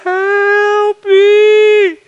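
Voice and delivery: long, high, very loud cry for help; desperate yell. Retold or imitated. Man alone at four a.m. yells to God, full-voiced. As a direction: yell it out, holding each word. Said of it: "I just yelled".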